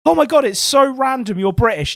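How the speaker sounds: high, excited imitation of an American fan; mimicking an American gushing over a Brit. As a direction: squeal it like a starstruck tourist. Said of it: "they're like".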